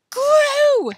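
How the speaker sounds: very high, very loud scream; sudden scream. A narrator's reading. Billy finds two worms glued together, sudden scream. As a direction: scream it out in outraged discovery. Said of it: "screamed Billy all of a sudden".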